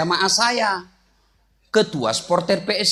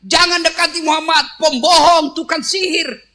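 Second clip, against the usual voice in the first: loud, high yelling; shouting; 8 semitones higher, 7 dB louder than usual. Acted. Blind man yelling insults while being fed. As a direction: bark out the insults. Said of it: "Yahudi yang teriak-teriak".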